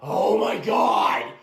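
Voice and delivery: slow, loud exasperated cry; exasperated shout. Acted. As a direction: throw it out in frustration. Prank vlogger loses patience.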